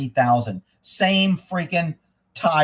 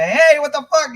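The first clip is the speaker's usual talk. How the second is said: high, loud type-A call-out; demonstrating loud talk; 12 semitones higher, 6 dB louder than usual. Shown as a demonstration. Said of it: "I fucking talk loud".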